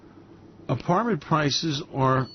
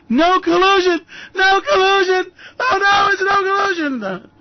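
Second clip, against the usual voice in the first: much higher, loud panicked yelling; mock nightmare scream; 14 semitones higher, 6 dB louder than usual. Trump imagined waking at night, high panicked screaming. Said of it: "wakes up in the night screaming".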